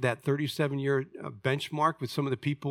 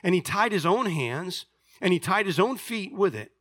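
projected, higher-pitched sermon reading; raised, emphatic pulpit reading; Scripture reading; 5 semitones higher than usual. A narrator's reading. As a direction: read the passage out strongly to the congregation. Reads a Bible passage aloud.

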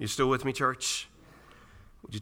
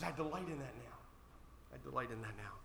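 hushed contentment; 14 dB quieter than usual. Retold or imitated. Preacher voicing Paul's delight in hardship, hushed and content. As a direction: let it land quietly, twice over. Quiet repeat after impassioned climax.

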